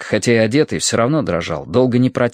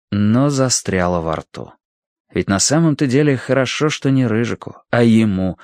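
ordinary delivery, nothing marked

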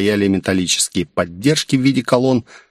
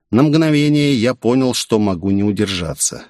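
plain reading voice

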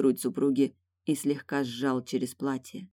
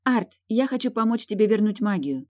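higher, gentle wife voice; tender female voice; 6 semitones higher than usual. Acted. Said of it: "нежный голосок супруги".

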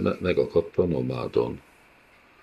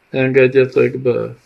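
put-on a high, thin dead child's cry, louder; wailing child; 7 semitones higher, 6 dB louder than usual. Ghost child begging to be let in, wailing high. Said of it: "vékony gyermekhangon felsírt valaki".

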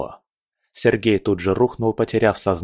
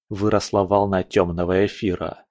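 usual speaking voice

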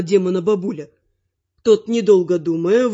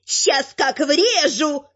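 slow, high-pitched troll threat; menacing troll-wife, pitched up; scowling troll woman; 7 semitones higher, much slower than usual. A narrator's reading. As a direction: draw out the threat as a she-troll. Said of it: "насупилась троллиха".

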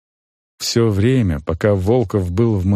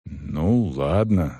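low, subdued consent; grudging peasant agreement; reluctant groom; 9 semitones lower, 4 dB quieter, much slower than usual. A narrator's reading. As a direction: give in with a low voice.

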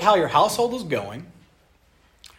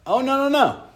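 teasing no; 7 semitones higher than usual. Real, not acted. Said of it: "Wives, you're not getting away".

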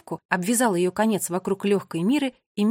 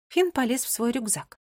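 ordinary delivery, nothing marked

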